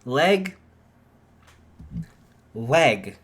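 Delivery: loud, deliberate LEG, LEG; enunciated. Acted. Izuku ordering his leg back, each word loud and deliberate. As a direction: bark each word out to force it.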